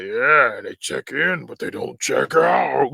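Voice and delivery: drawn-out villain quip; mock slasher taunt, stretched at the end; Freddy. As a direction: savor the one-liner slowly, in a put-on voice. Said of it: "Freddy's always got something clever to say".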